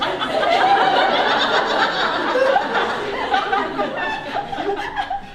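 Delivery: very high, loud extended laughter; long high laugh. Real, not acted. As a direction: cackle helplessly at it. Laughs at stone in bra.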